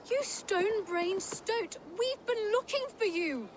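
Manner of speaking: high-pitched exasperated outburst; indignant sibling telling-off; exasperated scolding. Acted. Scolds missing brother on finding him.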